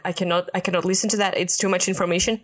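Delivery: fast, flustered inner panic; fast overwhelmed plea; overwhelmed rush. Retold or imitated. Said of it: "I was just like".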